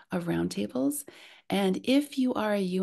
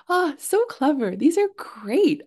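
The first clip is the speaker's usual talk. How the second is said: high, loud burst of real delight; thrilled, emphatic admiration; delighted praise; 8 semitones higher, 8 dB louder than usual. Real, not acted. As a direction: gush over a clever answer, pitched up and drawn out. Delighted by participants' clever answers.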